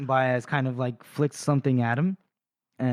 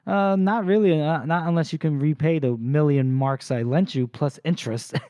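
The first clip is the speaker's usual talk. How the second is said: smug mock reply; 3 semitones higher, 4 dB louder than usual. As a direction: lord it over him with a teasing lift. Baez mocking Glokta's debt.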